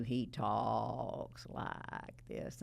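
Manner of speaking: very slow, drawn-out imitation of a client; annoying client voice. Lawyer warns the jury about client's voice, slow annoying imitation. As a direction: drag each word out, mimicking an irritating talker. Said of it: "his voice is gonna annoy you".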